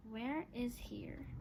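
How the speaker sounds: ordinary delivery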